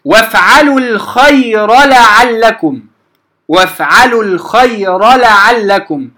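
slow وافعلوا الخير لعلكم with the ra said clearly; slow recitation, ra kept apart from the lam; clear ra demo. Shown as a demonstration. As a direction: keep the ra in الخير, no merging. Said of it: "مثال لما امتنع إدغامه".

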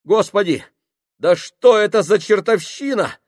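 nervous plea building to a frantic, high, loud cry; panicked pleading. Acted. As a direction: start uneasy, end in near-hysterical panic. Panicking sailor pleads with crew.

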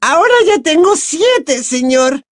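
very high, loud squeaky elf delight; excited elf. A narrator's reading. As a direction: gush in a tiny elf squeak. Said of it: "Dobby estaba emocionado". (elfo emocionado, voz chillona y muy aguda)

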